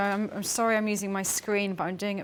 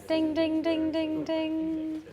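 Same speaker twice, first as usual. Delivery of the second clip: higher, monotone sung-out dinging; be the timer bell, ringing on one held pitch; voiced bell chime, last ding held long; imitated bell; 8 semitones higher, 3 dB louder, slower than usual. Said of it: "that's your bell".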